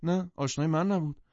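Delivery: high-pitched protest of denial. A narrator's reading. Daisy disowning the wedding guest, in denial. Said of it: "دیزی انکار کرد".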